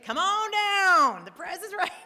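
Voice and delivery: booming slow Price Is Right call; game show announcer. Retold or imitated. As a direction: call them down like a TV host.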